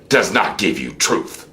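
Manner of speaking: normal talking voice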